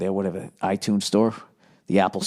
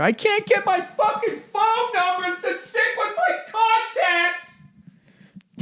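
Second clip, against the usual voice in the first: whiny shit fit. Retold or imitated. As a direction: throw a high whiny tantrum. Mock whiny rant about phone.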